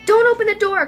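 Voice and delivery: loud, high warning call. A narrator's reading. Crystal warns her daughter, calling out loud and high. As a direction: shout a warning across the house. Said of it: "Crystal called".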